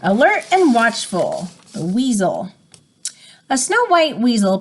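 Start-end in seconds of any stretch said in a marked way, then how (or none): none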